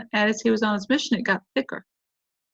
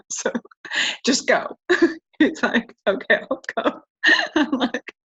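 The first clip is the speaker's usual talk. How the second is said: laughing through the words, pitched higher; 6 semitones higher than usual. Real, not acted. Mother recalling telling her son to just go, laughing. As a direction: tell it through bubbles of laughter. Laughs while retelling the exchange.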